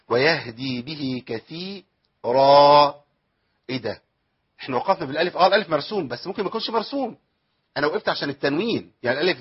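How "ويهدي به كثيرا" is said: ويهدي به كثيرا drawn out, unhurried; slow measured recitation. Shown as a demonstration. Teacher modelling the correct calm reading for students. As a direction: recite ويهدي به كثيرا slowly, every letter in place. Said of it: "ما تجريش".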